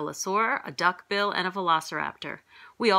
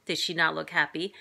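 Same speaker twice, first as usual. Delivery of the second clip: ordinary delivery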